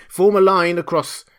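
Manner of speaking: high, loud command; urgent cry. A narrator's reading. Kemp ordering the pursuers, urgent cry. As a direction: shout the order across a crowd. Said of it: "he cried".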